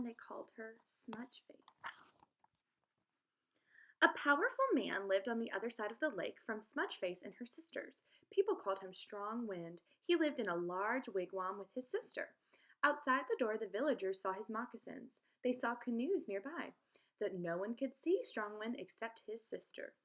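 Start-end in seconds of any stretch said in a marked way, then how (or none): 0.0-1.9 a hushed whisper on the name; whispered nickname. A narrator's reading. 4.0-19.2 lively read-aloud storytelling voice; story read aloud. A narrator's reading. Teacher reads story aloud.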